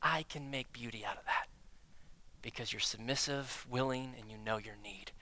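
quiet, gentle, reassuring voice of God; soft reassurance. Retold or imitated. God comforting the submissive believer, softly and gently. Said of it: "And he goes".

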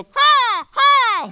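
imitated crow caw, very high and loud. Preacher imitating a crow for the congregation, with a loud high caw. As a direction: squawk like a crow. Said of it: "you know how birds go".

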